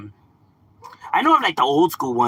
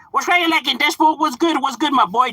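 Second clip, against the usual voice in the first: high, loud hype greeting to chat; hyped greeting; 13 semitones higher, 4 dB louder than usual. Real, not acted. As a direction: shout out the chat, pumped.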